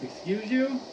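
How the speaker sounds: usual speaking voice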